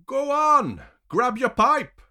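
very high, loud goading; loud, high-pitched egging on; goading shout. A narrator's reading. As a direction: shout him on mockingly. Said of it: "the other man encourages me".

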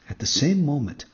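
plain reading voice

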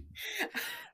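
helpless high-pitched laugh; high squealing laugh. Real, not acted. Laughing at the story.

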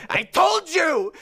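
high, slow triumphant yell; vindicated shout. Retold or imitated. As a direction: crow it out triumphantly. Artist vindicated.

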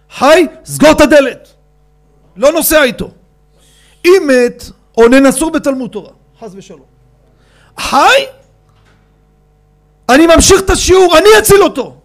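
high, loud, dismissive tirade; angry shout. Retold or imitated. Father refusing to leave his lesson, angry shouting. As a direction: order them out, furious.

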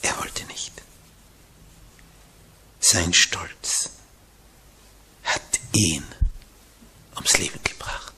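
low, soft and grave; solemn near-whisper about Judas; grave hush. Real, not acted. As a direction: let the words sink quietly.

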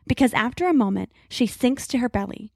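ordinary delivery

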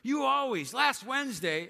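hammed-up accusation in a fight; mock spouse accusing. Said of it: "they're like".

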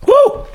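excited whoop. Real, not acted. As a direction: whoop at the burn. Reacting to hot sauce.

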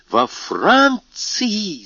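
the king cries out, stunned by Napoleon's landing; shocked cry. Acted. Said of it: "закричал он".

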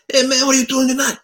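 put-on high, loud Italian accent; Antonio's Italian voice. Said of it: "he speaks with him".

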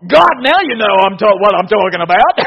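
loud and laughing through it; laughing exclamation. Retold or imitated. Preacher joking as Adam to God, loud laughing exclamation. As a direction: crack up mid-boast.

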